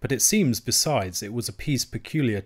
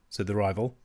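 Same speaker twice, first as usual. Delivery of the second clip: ordinary delivery, nothing marked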